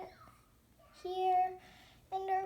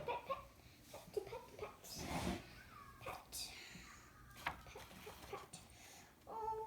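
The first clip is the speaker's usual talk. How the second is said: much softer, half-whispered petting; 3 semitones higher, 16 dB quieter, faster than usual. Pretend. Petting the toy puppy, soft half-whisper. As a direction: hush while stroking the puppy.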